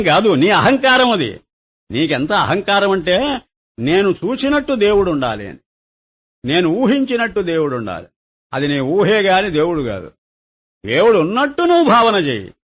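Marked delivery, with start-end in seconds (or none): none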